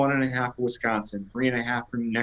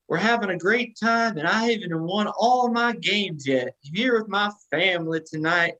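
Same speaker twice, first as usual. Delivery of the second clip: higher-pitched mock Cajun accent; Brian Kelly Cajun accent; 8 semitones higher than usual. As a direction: imitate a coach's put-on Cajun accent at a press event. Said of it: "got his Cajun accent".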